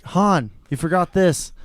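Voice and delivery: calling out. Retold or imitated. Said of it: "the random dude's just like".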